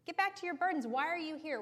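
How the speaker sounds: high, scornful king shooing people away; scornful Pharaoh. Acted. Pharaoh waving off the elders in the room, high and scornful. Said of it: "Can you imagine him gesturing to the elders".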